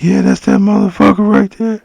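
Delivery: high, loud menacing voice urging an attack; creepy voice. Retold or imitated. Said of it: "He would use this voice".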